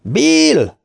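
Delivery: very high, drawn-out holler; high screamed call, falling at the end; all-out yell. A narrator's reading. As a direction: yell the name as far as it will carry. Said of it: "ordított Richie, amilyen hangosan csak bírt".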